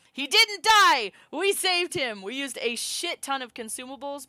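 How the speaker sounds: loud, high cheer of relief; jubilant shout. Real, not acted. Player celebrating saving Calm, jubilant high shout. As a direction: cry out in triumph.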